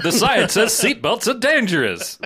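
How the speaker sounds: hammed-up vintage announcer; old-timey voice. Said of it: "the old-timey voice".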